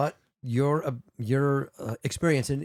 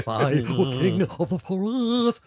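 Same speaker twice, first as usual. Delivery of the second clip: slow, quavering cowardly lion; 6 semitones higher, 4 dB louder, much slower than usual. Retold or imitated. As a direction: stretch it out like the timid movie lion. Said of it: "the best cowardly lion from The Wizard of Oz".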